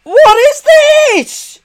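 very high, drawn-out horrified squeal; slow, piercing cry of horror; horrified shriek. Real, not acted. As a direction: stretch it out high in disbelief. Horrified at the search results.

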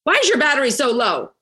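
high, fast mock scold; hammed-up little rant at a phone. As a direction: playfully tell off a phone with a dead battery. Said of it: "Do you yell at your cell phone".

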